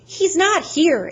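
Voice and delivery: high, loud, slow urgent yell. Acted. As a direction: shout it at them in alarm. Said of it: "Annabeth yelled".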